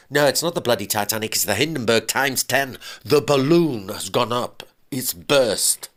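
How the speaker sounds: husband insists to his ex-wife that all is lost; desperate husband. Acted. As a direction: insist the disaster is real. Husband's voice again on the phone.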